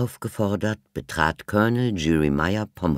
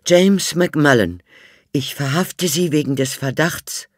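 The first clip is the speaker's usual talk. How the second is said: official arrest; 11 semitones higher, 8 dB louder than usual. A narrator's reading. The constable arrests the butler, loud and formal. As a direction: declare the arrest with authority.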